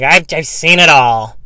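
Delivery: hammed-up wise-guy drawl; mocking people who claim to have seen it all; mock know-it-all. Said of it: "Don't you love when people are like".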